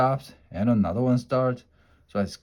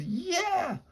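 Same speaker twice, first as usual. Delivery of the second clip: screamed; 5 dB quieter than usual. A narrator's reading. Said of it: "Leslie screamed".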